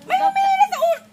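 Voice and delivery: high, loud alarmed cry; shouting. Real, not acted. Victim yells about pulling.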